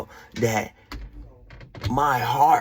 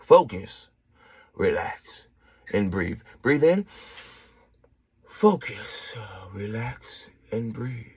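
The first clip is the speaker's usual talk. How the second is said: lower, soft, slow breathing cue; calm guided breathing; 6 semitones lower, 3 dB quieter, slower than usual. Shown as a demonstration. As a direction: guide them down gently. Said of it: "Do it with me".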